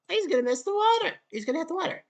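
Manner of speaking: squeaky mocking golfer voice; high mock voice. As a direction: pitch it way up, mocking him. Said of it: "He was like".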